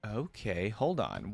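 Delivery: normal talking voice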